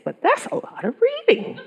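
very high, squeaky mock protest with a laugh; comic dismay. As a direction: shoot way up high, comically overwhelmed. Overwhelmed reaction to the pastor's order.

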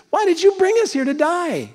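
high mock whine; whiny complaint. Israelites complaining to Moses.

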